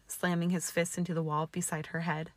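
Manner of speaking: usual speaking voice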